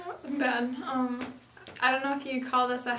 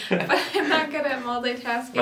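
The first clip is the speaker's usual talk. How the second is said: laughing; 5 dB louder than usual. Real, not acted. Answers his teasing, laughing.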